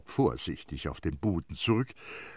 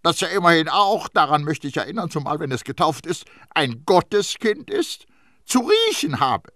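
high, loud cross-examination; stern interrogator, voice raised; sharp questioning; 11 semitones higher, 8 dB louder than usual. A narrator's reading. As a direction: build to a pointed, accusing question. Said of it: "und fragte scharf".

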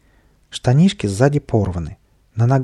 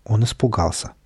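plain reading voice

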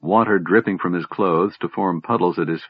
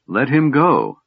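higher-pitched, insistent command; demanding; 7 semitones higher, 4 dB louder than usual. A narrator's reading. Sister demanding her brother's healer be released, insistent. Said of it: "Laurana demanded".

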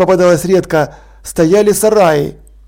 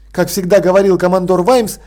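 ordinary delivery

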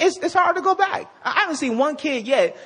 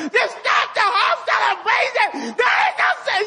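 mock angry atheist; 11 semitones higher than usual. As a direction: yell it like a furious Santa denier. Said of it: "I'm like".

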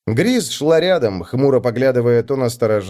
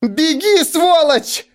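high, loud desperate scream; 3 dB louder, much slower than usual. A narrator's reading. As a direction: scream at him to run. Pinned, screaming at him.

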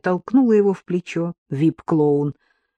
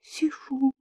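soft, high hushed reply; whispering on the phone; 8 semitones higher, 7 dB quieter than usual. Acted. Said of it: "почему-то шёпотом ответила она".

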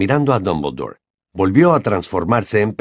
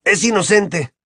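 shrill cry; 10 semitones higher than usual. A narrator's reading. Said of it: "chilló Ron".

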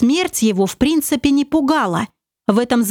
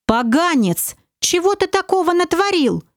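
nervous thought; 7 semitones higher than usual. Acted. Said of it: "нервно соображал командор".

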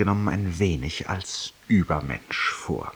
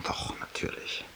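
a secret admitted under the breath; whispered aside; 14 dB quieter than usual. Acted. Confessing to his listener in a whisper.

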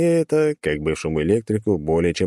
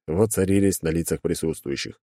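ordinary delivery

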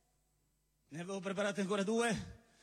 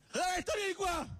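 shouted call; 8 semitones higher than usual. Acted. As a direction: yell it across the room.

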